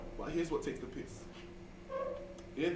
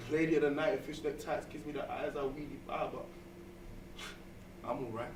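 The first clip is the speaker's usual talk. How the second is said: spoken word poem; 2 semitones lower, 4 dB quieter than usual. Acted. Rhythmic poem piece.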